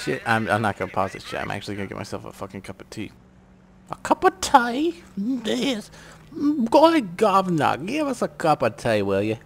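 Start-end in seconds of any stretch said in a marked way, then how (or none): 3.9-5.0 high, drawn-out mock British accent; slow, loud put-on British squeal, rising as a question; Emma Watson. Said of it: "That was my Emma Watson accent". 5.2-9.4 high, hammed-up British accent; loud mock British voice, swooping down from a squeal; Emma Watson. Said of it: "That was my Emma Watson accent".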